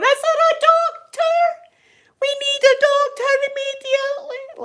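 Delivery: high, loud frantic cry for a doctor; panicked call for help. Retold or imitated. Child's foot is bleeding, high frantic shout for a doctor. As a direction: yell for help up the hills. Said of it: "And I'm going".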